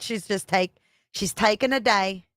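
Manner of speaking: laughing, light attempt at a Jersey accent. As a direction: try out Nicole's Jersey accent. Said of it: "I can't do that Jersey accent".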